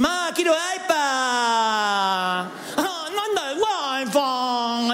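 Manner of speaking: put-on high whiny child demanding from mom; spoiled kid voice. Spoiled kid whining to mom about the iPad. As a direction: whine like a spoiled brat. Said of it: "esos nenes que dicen".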